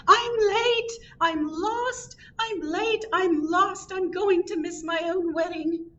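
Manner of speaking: very high-pitched yelling bride. A narrator's reading. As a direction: cry out way up high, running late. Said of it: "She was yelling".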